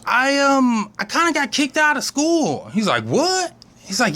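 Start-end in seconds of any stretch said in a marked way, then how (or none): 0.0-2.7 put-on higher sheepish kid confessing; lightly voiced little girl. Susie voiced, child. 3.0-3.5 shocked what. Retold or imitated. Trash man shocked.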